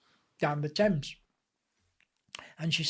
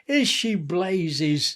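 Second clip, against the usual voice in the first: drawn-out, louder scoffing denial; emphatic retort; 5 dB louder, much slower than usual. Retold or imitated. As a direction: scoff it slowly and firmly, as a retold comeback. Mock-indignant retort to friends.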